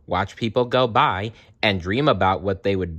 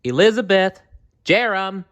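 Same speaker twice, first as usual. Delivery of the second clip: high, loud mother's call; mother calling children home; 12 semitones higher, 5 dB louder than usual. Acted. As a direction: call the kids from afar. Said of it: "their mother called".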